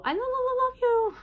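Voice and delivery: very high stuttering sing-out of "love you"; weird echolalic repeat. Shown as a demonstration. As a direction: repeat the syllable high and loud, then release. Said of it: "I say it weirdly".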